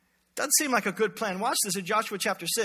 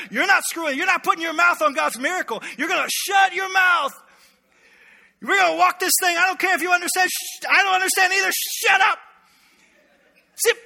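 higher, louder stern commander's shout; Joshua shouting; 8 semitones higher, 6 dB louder than usual. Retold or imitated. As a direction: bark orders at the grumbling people. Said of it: "I just imagine Joshua".

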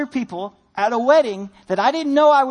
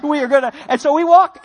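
usual speaking voice

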